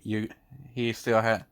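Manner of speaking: usual speaking voice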